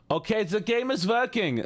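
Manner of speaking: high, triumphant announcement; excited high voice. Real, not acted. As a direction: squeal it with delight.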